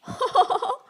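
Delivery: loud, high mocking cackle; a nasty little bird's laugh; mean cackle. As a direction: cackle at the tree's pain. Naughty crow mocks weeping tree.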